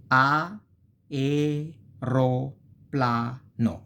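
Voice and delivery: A, e, ro, pla, no each said apart; teacher dividing the word into syllables. Shown as a demonstration. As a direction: split aereoplano into clear syllables. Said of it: "clearly a mistake".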